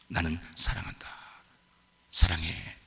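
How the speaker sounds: low, soft and tender; soft love words. Retold or imitated. Husband softly telling his wife he loves her, low and soft. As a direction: murmur it close and low.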